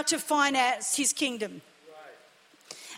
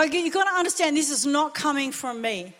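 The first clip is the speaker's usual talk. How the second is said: ordinary talk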